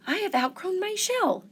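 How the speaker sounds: high small creature voice; little crab voice. A narrator's reading. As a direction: ask shyly as a little crab.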